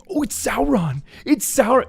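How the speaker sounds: high-pitched put-on fanboy thrill; mock excited fan. Fan nudging his buddy at Sauron, mock excited. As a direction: gush it like an overeager kid. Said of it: "nudging his buddy".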